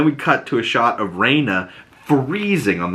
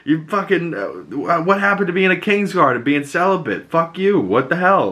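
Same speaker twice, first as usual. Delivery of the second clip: higher-pitched, indignant retold challenge; mock confrontation; 7 semitones higher than usual. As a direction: call him out in mock outrage. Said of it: "and calls him out".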